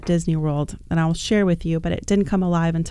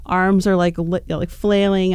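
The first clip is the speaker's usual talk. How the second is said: ordinary delivery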